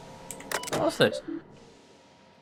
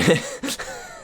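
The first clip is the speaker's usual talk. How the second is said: squeaky laugh breaking out; can't hold the giggle in; short helpless high laugh; burst of high laughter. Real, not acted. Cracks up reading the log.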